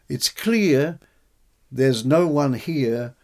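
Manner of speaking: a dramatic verse reading for radio; reciting his translation. Shown as a demonstration. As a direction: let the line sink to a slow whisper. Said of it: "So here's one line that".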